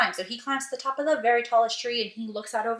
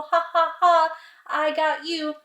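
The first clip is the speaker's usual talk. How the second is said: slightly raised, spoken ha-ha gloat; mock laughing taunt; 4 semitones higher than usual. Peter gloats to the townspeople, mock laughing. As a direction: tease with a pretend laugh, dragging it out. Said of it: "and he's laughing".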